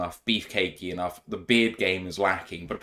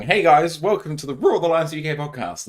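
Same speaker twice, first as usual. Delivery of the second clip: louder, higher, cheery podcast host greeting; podcast host intro; 7 semitones higher, 4 dB louder than usual. Pretend. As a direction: open like a peppy podcast host.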